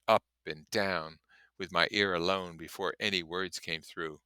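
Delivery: plain reading voice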